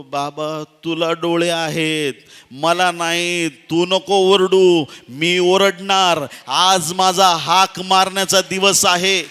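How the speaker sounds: high, very loud, drawn-out cries; slow, impassioned bellowing plea; defiant shouting. Acted. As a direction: shout over everyone trying to silence you. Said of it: "ओरडत राहिला".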